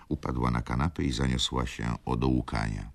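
ordinary delivery, nothing marked

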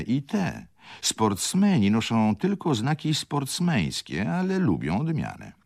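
lightly voiced fawning shop assistant; eager shop clerk. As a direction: coax the customer politely upward. Shop clerk pushes more goods.

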